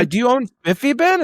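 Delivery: a customer's high, eager question; excited mom. Retold or imitated. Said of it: "And she's like".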